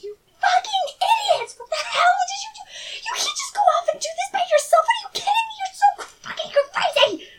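very high, frantic acted shriek; screaming obscenities. Bard clambers down to his companions, screaming obscenities. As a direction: shriek abuse at your companions. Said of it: "Screaming in obscenities as he goes".

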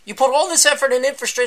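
can't believe what he's hearing; mock exasperated disbelief; incredulous. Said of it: "I'm like".

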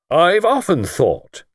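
slow, self-satisfied musing; pompous lord mulling aloud; complacent. Acted. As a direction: savour the thought smugly. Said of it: "said his lordship complacently".

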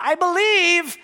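high, loud desperate cry. Acted. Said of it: "the father cries out".

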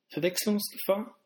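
normal talking voice

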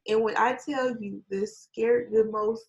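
usual speaking voice

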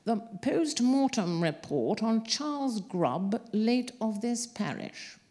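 put-on thin reedy slow old coroner; slow and reedy, clearing the throat. A narrator's reading. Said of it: "the coroner said in a reedy voice".

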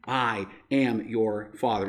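low and slow, with a pause after each word; slow it right down into a deep Vader reveal; Darth Vader impression. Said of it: "He says".

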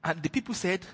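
ordinary talk

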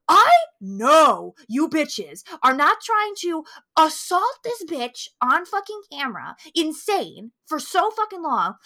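worked-up anger. Real, not acted. Host berates filmmakers over the assault scene, worked up. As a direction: rant in incredulous outrage. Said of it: "I'm getting worked up".

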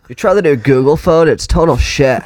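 high, loud whiny tech reviewer; goofy reviewer voice. Pretend. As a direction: complain like a disgruntled reviewer.

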